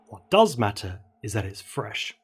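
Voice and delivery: ordinary delivery, nothing marked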